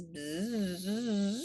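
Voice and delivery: long wavering buzz lifting at the end; bee buzz. Shown as a demonstration. Teacher contrasting articulations, long smooth rising buzz. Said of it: "the difference between the buzz".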